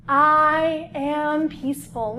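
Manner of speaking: slow, even, deliberate chant; calm affirmation. Shown as a demonstration. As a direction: say it slowly and evenly like a mantra. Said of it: "yoga pose affirmation".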